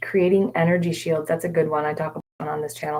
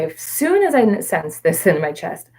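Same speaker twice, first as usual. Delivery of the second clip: ordinary talk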